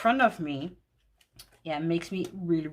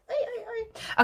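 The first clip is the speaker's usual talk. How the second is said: delighted. Real, not acted. Delighted by a card pairing.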